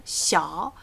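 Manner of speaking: "Xiao" falling then rising; "Xiao" with a scooped down-up tone; third tone, down and up. Shown as a demonstration. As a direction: dip the pitch of "Xiao" and lift it. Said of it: "down and up".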